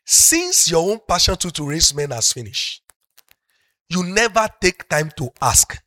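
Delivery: usual speaking voice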